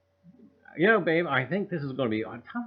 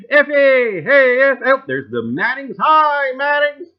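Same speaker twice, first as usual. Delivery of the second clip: excited greetings; 12 semitones higher, 16 dB louder, slower than usual. Real, not acted. Greeting chat viewers loudly.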